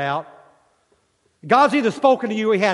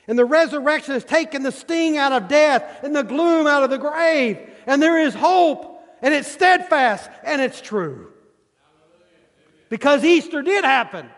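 rising preaching; 9 semitones higher than usual. Acted. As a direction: build the proclamation up, each claim bigger. Preacher builds to climax.

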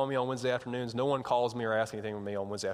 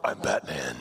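very low superhero voice; Batman; slower than usual. Retold or imitated. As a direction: drop way down into a mock-heroic growl. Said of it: "like the Batmobile when you're going real fast".

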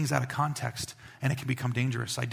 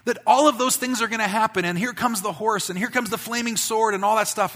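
louder, higher hammed-up end-times hype; rising, louder mock thrill over horses and flaming swords; mock excitement; 7 semitones higher, 6 dB louder than usual. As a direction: ham up the believers' breathless excitement. Preacher mocks end-times believers.

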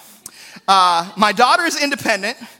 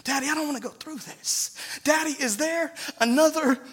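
pleading prayer; 4 semitones higher than usual. Acted. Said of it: "he cries out to his daddy".

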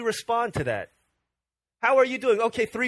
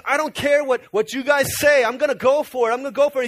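defiant resolve; 4 semitones higher, 6 dB louder than usual. Acted. Bartimaeus defies the crowd.

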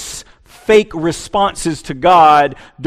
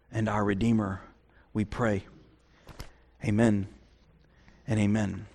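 pastoral prayer; 7 semitones lower, 7 dB quieter than usual. Acted. Pastor praying over the congregation, soft and low. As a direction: pray it quietly and reverently.

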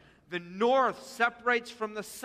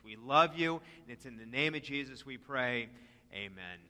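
closing prayer; 6 semitones lower than usual. Real, not acted. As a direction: lead the church reverently in prayer.

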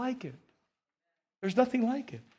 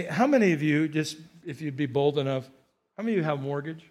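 normal talking voice

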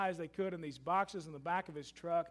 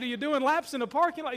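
laughing tease; 7 semitones higher, 7 dB louder than usual. Retold or imitated. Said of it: "with a grin on his face".

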